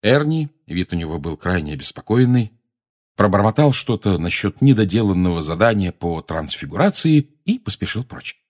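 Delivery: ordinary delivery, nothing marked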